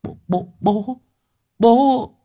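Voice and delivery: put-on boy stuttering high, in shock; boy stammering at seeing his father. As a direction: stammer out the word in disbelief. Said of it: "miệng nó lắp bắp".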